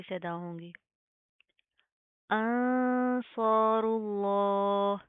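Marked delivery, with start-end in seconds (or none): 2.3-5.0 very slow sung tajweed recitation; Quranic recitation. Shown as a demonstration. Teacher recites the verse slowly for students. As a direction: chant it slowly, drawing every vowel out. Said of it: "recitation demo".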